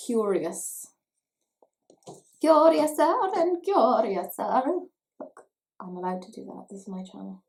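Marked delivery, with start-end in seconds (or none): 0.1-4.6 loud, high, drawn-out storybook exclamation; hammed-up and playful, way over the top; theatrical quoting. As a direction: declaim it grandly, stretching each word. Hams up shade name as quotation.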